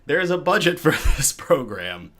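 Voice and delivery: amused jab at his own budget, chuckling mid-line; laughing through it. Real, not acted. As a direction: laugh out the words. Joking about budget, laugh heard.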